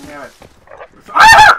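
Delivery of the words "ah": sudden shrill shriek; high loud scream. Real, not acted. Startled by a game attack, high scream. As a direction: cry out in shock.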